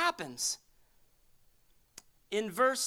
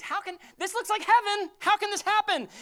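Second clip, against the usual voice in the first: high, louder astonished questioning; acted amazement; 11 semitones higher, 6 dB louder than usual. Divided Pharisees marvelling at the healing, acted amazement. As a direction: exclaim it in hammed-up wonder.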